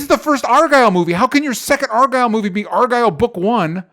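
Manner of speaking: high, loud disbelieving rant; exasperated rant. Real, not acted. Host ranting at the movie for calling its sequel book one, loud and exasperated. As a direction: rail at the film for its nerve.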